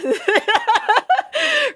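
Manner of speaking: high bursting laugh. Real, not acted. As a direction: let a real high laugh break out. Laughing at the story setup.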